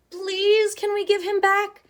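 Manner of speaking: put-on high child raccoon pleading; Chester pleading. Chester begs his mother, high pleading child voice. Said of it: "he asked his mother".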